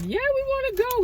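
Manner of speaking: a sudden high squeal of retold excitement; excited quote. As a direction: jump way up in pitch, thrilled to be going. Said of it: "We all excited".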